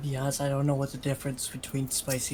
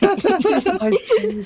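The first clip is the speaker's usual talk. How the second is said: laughing; 8 dB louder, slower than usual. Real, not acted. Laughing through a joke.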